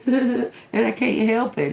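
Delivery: real giggle spilling into the words; giggling. Real, not acted. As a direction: laugh helplessly as you talk. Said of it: "I start going".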